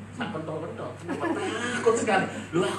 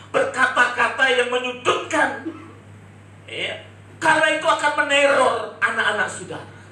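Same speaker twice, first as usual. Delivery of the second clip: full-force preaching; 6 semitones higher, 7 dB louder than usual. Acted. Preacher warning parents in church, loud preaching. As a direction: hammer the warning home to the congregation.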